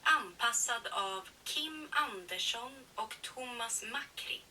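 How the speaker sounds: ordinary delivery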